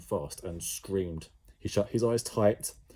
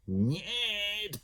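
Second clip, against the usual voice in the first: bellowed; 13 semitones higher than usual. A narrator's reading. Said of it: "bellowed Jemima".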